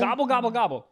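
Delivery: gobbling like a turkey; turkey impression. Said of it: "that's a good turkey impression".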